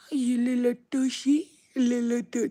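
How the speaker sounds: high, loud doting squeal; loud baby talk. Retold or imitated. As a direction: act out gushing at a toddler, high and loud. Acts out cooing at a baby.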